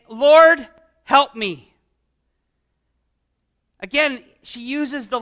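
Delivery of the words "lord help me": "Lord, help me" cried out loud, louder on "Lord"; loud plea. Acted. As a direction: call out "Lord" loudly, begging for help. Woman's plea, first voicing.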